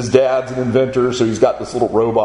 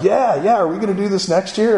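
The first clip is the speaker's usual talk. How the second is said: high-pitched, excited imitation of schoolboys; eager boys; 9 semitones higher than usual. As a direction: retell the kids' eager pleading, pitched up. Boys eagerly asking their leader.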